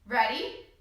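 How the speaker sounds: ordinary talk, nothing marked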